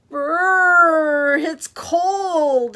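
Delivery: high, very loud drawn-out shiver; freezing. Acted. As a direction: exclaim as if plunged in icy water. Girl flies out of icy water.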